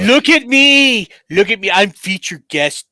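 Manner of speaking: hammed-up attention-seeking shout, high and loud; mock yelling. Featured guest bragging to a convention crowd, mock yelling. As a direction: yell it out like a show-off. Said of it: "convention yelling".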